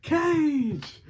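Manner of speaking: playful imitation of a high yell; mock yell. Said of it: "he yells it".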